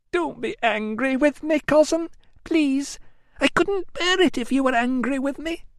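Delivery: put-on high, plaintive young woman's wail; whiny Amelia. A narrator's reading. Amelia wailing to her cousin, high and plaintive. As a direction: wail it like a spoilt cousin begging pity.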